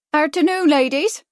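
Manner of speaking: high, loud, chirpy shopkeeper; chirping Cockney greeting. A narrator's reading. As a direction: greet the customers with a cheery chirp. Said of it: "she chirped".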